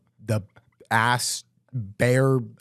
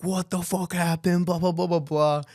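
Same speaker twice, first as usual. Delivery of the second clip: high, excited mock rant full of blah blah blah; hammed-up drunk fury, pitched up; drunk angry rant; 8 semitones higher than usual. Drunk bandmate ranting at the guys on the phone, high mock anger.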